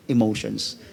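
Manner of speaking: ordinary talk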